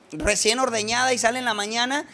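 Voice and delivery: ordinary delivery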